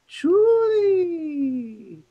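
sneeze shout "Julie". Retold or imitated. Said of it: "and then I'd be like".

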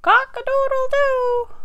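very high, loud cock-a-doodle-doo; rooster crow. A narrator's reading. Storyteller as the rooster calling to the spider, loud high crowing. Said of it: "crowed the rooster".

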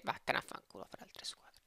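whispered angry curse; explosive italian cursing. Real, not acted. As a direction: hiss the insult under breath. Cursing rejected deal. (imprecazione sussurrata con rabbia)

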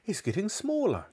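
put-on high-pitched boyish amazement; excited boy. A narrator's reading. George watching the hen shrink, high excited voice. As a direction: marvel in a high young voice.

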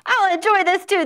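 high, loud, fast hen voice. Acted. Storyteller voicing a gleeful hen joining the fray. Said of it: "the hens went cluck".

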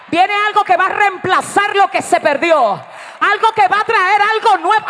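loud, high-pitched exhortation to the congregation; full-force preaching. Real, not acted. Preacher rousing the congregation about something new coming, full-force preaching.

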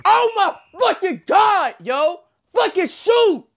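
high, drawn-out yell at the game; exasperated yell. Real, not acted. Yelling at game players.

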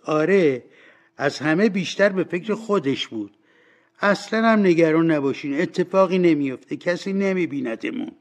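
high, loud gossiping washerwoman; agreeing washerwoman. Acted. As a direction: agree loudly and dismiss the worry.

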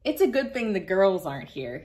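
usual speaking voice

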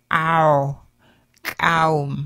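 "ow" squeezed low back in the throat; "ow" with a tight pharyngeal squeeze; rounded pharyngeal. Shown as a demonstration. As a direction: press the "ow" down into the throat. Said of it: "ow back in the throat".

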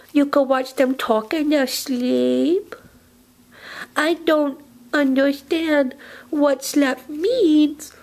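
ordinary talk, nothing marked

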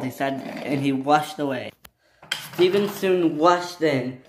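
usual speaking voice

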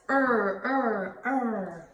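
"Er" as a held r sound; letter sound demo. Shown as a demonstration. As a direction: draw out "Er" three times. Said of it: "Robbie Rabbit says".

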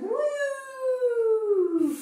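high happy woo; playful woo. Pretend. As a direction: cheer as the worm wiggles. Wiggling like a worm.

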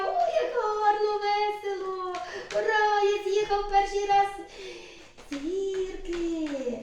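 delighted little hero cheering; excited cheer. Pretend. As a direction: celebrate the first slide. Toy slides down, cheers.

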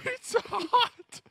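high, slow stretched-out Pizza Hut; mock disbelief. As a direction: hold up the box and drawl it in disbelief. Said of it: "He comes back with this".

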